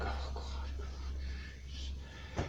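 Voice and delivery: whispered exasperated groan; weary mock whisper. Family members dreading a needy relative, whispered exasperation. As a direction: dread it under your breath. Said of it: "even family members still like".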